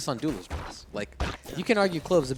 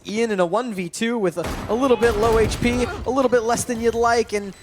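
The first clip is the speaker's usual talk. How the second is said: esports caster; 8 semitones higher, 6 dB louder than usual. Acted. Play-by-play hype.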